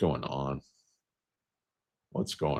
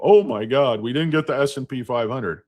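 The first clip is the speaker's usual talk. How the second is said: higher, louder whiny fretting; mock panicking shareholder; 7 semitones higher, 3 dB louder than usual. Mocking fretful investors with a higher whine. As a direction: mimic the doom-sayers. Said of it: "all this fud".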